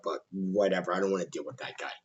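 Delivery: very low, hushed grumbled aside; dismissive aside. Real, not acted. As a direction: drop it right down under your breath. Muttered dismissive aside about Gunn.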